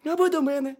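very high, loud hedgehog squeak; piping cartoon critter voice; little hedgehog. A narrator's reading. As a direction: chip in as a tiny hedgehog. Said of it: "сказав їжачок".

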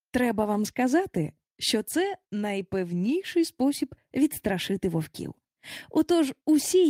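plain reading voice

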